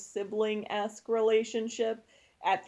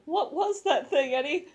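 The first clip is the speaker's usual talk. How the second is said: lightly voiced elderly shop owner; Missus Chen; 6 semitones higher, 4 dB louder than usual. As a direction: pitch up into a startled old shopkeeper. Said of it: "Missus Chen".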